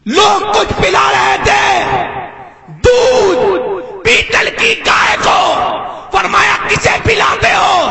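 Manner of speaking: high shouted phrases with long pauses; drawn-out, high sermon cry; full-force preaching. Real, not acted. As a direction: proclaim it piece by piece to the crowd.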